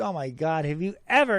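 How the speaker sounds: ordinary talk, nothing marked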